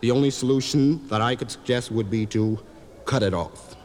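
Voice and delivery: lightly voiced doctor, low and measured; understated low professional voice. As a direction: deliver grave advice as a frank physician. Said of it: "Doctor said".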